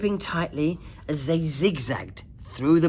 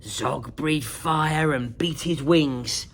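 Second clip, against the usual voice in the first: plain reading voice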